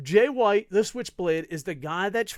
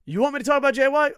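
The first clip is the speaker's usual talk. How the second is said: higher, louder, fast mock fan question; 9 semitones higher, 9 dB louder, much faster than usual. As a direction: needle him like a nagging fan. Mocking fans asking.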